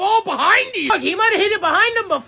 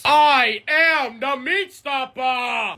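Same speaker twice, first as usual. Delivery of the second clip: low, slow, booming Meat Stopper line; deep slow catchphrase; 7 semitones lower, much slower than usual. Acted. Big guy booms his catchphrase at the crew. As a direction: announce yourself like a heavy brute.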